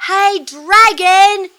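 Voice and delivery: high, very loud, drawn-out yell; slow bellowed call, every word stretched; full-out shout. A narrator's reading. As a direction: holler as loud as you can into a sleeper's ear. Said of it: "she shouted as loud as she could".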